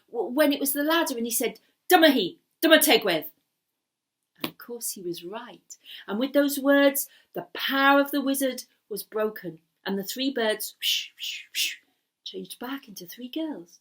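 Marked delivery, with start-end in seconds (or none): 1.9-3.3 triumphant call. Acted. 10.8-11.8 whoosh. Acted.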